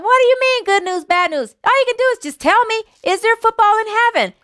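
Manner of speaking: put-on very high, eager old man; impatient excitement. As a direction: demand the answer right now. Impatient demand for the answer.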